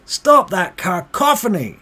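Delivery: high, very loud heckling; yelled, outraged jeering; angry shouting. Acted. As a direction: shout them down like a fed-up tavern crowd. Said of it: "they start shouting".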